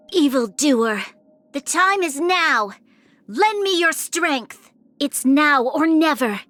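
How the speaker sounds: rising commanding battle call; rally the troops, voice climbing; battle cry. Acted. Battle cries in combat.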